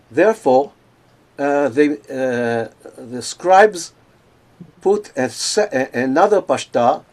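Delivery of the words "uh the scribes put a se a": ordinary talk, nothing marked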